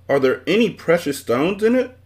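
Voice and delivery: put-on a boy bursting with excitement; child asking eagerly, voice lifted high; excited. A narrator's reading. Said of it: "asked Milo excitedly".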